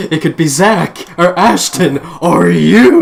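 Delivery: mocking Isabella. Mocking the frightened friend's accusations, loud and hammed-up. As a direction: ham up the panicked list.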